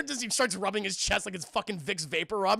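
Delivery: ordinary talk, nothing marked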